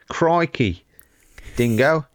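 hammed-up Australian exclamations; mock Aussie accent. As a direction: throw out Aussie clichés with a grin. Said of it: "That was the Aussie one".

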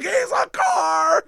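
high, slow crying-laughing yell; laughing shout. Retold or imitated. Gangsters mocking him at the party, laughing shout. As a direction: howl it through laughter. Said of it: "They're like".

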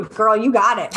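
ordinary talk, nothing marked

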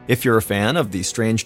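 ordinary delivery, nothing marked

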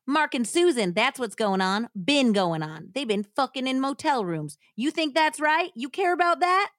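angry hillbilly husband, Southern twang; hammed-up Southern outrage; Southern accent. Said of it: "read this in a Southern accent".

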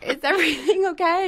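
put-on high, careful sing-out to a child; retold high-pitched parental check-in; wary mom calling. Mother calling to suspiciously quiet child.

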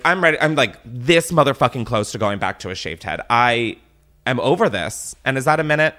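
ordinary talk, nothing marked

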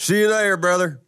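put-on Southern accent. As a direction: greet like a Southern buddy. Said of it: "with your accent".

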